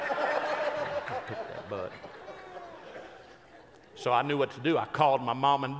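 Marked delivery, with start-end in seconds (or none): 0.1-2.0 very high, slow strained squeak; squealed punchline. Real, not acted. Preacher repeating his hang-up punchline to congregation, squealed.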